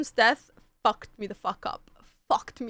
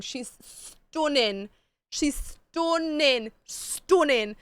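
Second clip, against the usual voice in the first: very slow, exaggerated emphasis; drawn-out gushing; 3 semitones higher, much slower than usual. Real, not acted. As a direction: stretch STUNNING out long, vowel rounded toward "stoon". Gushing over the actress, drawing it out.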